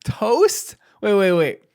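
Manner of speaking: high, loud disbelieving question, then halting them; incredulous. Real, not acted. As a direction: balk loudly at the answer. Incredulous at the breakfast answer.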